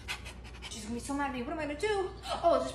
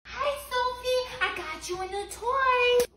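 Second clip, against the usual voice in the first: pet voice; 9 semitones higher, 4 dB quieter than usual. Acted. Talking sweetly to the cat.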